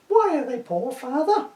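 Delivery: put-on high, young boy's voice; a posh boy asking his dad innocently.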